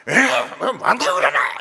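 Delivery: loud, high gruff Gyeongsang grumble; gruff Gyeongsang dialect. Retold or imitated. Gyeongsang man brushing off his wife, loud gruff dialect. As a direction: bark it like a blunt Gyeongsang man. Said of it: "경상도 사람들은 막 겉으로는 막".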